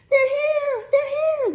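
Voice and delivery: put-on high, loud little boys yelling; high joyful shout. A narrator's reading. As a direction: scream it like excited kids. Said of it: "they shouted".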